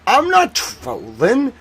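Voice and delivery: high, slow innocent voice; ironic zoomer. Pretend. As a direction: play dumb, wide-eyed and high. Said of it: "you gotta be like".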